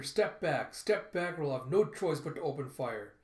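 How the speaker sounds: loud crowd-control order; shouted warning. Acted. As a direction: order them back forcefully. Guard warns crowd of protesters.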